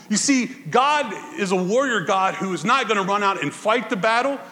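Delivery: normal talking voice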